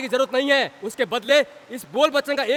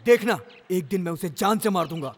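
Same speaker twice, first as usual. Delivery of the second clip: low, simmering threat; brooding vow; 6 semitones lower than usual. Acted. Humiliated man vowing to kill his tormentor, brooding vow. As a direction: swear revenge in a low voice.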